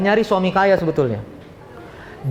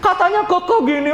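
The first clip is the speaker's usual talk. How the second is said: higher, indignant complaining mother's voice; demanding customer mom; 9 semitones higher than usual. Retold or imitated. Customer mother complaining to the seller, higher indignant whine. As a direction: play a fussy mom whose order ran out. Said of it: "Ibu-ibu apalagi".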